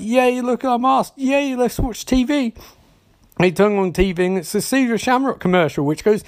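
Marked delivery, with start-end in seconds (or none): 0.0-2.5 high-pitched mock kiddie cheer; imitating excited kids. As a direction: cheer as the delighted children, pitched up. Voicing excited kids in story.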